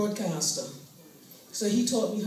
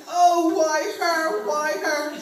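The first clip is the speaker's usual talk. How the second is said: loud, slow wail with a higher pitch; copycat wailing; 6 semitones higher, 7 dB louder, much slower than usual. Retold or imitated. Cousin at a stranger's funeral, copying the loud wail. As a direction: copy the mourners' wail, stretched out. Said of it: "I was screaming".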